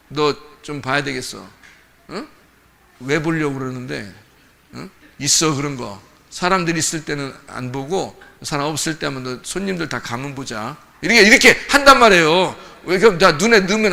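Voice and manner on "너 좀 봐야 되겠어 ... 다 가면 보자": low, quiet veiled threat; hushed parental warning. Acted. Parent warning a child while guests are around, low and hushed. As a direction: keep it down so guests won't hear.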